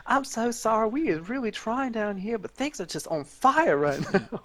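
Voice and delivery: imitating the sweet Southern belle apologizing. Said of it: "this Southern Belle answers".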